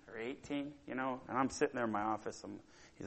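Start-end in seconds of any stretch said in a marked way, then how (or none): none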